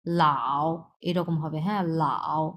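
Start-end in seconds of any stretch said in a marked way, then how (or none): 0.1-0.7 লাও with a dipping V-shaped third tone, lower. Shown as a demonstration. Teacher shows students the third tone, dipping V shape. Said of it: "so উচ্চারণ V shape". 2.0-2.4 লাও dipped low in a V, falling then rising; third tone. Shown as a demonstration. Teacher repeats the third tone for beginners, dipping V shape. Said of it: "V shape উচ্চারণ হবে".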